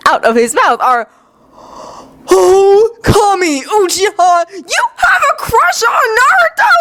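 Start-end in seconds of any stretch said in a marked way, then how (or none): 2.3-6.7 very high, incredulous cry; squealed disbelief; shocked outburst. Acted. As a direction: blurt it out, pitch soaring into a question. Kiba blurts out shocked discovery.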